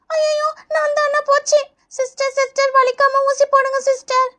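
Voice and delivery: put-on high child voice, nervously begging; anxious pleading. Child whose turn has come asks nurse for a painless injection, anxious pleading. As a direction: plead gently like a worried pupil.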